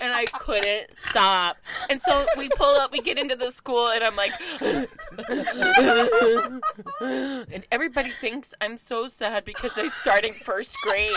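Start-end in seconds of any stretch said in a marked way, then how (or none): 4.4-6.9 high, heaving sob noises; exaggerated crying fit, laughing underneath; mock sobbing gasps. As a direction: gasp and heave through hammed-up crying. Acts uncontrollable sobbing at school.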